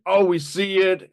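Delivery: playful quoted outburst; mock realization. Acting out spotting the snipers.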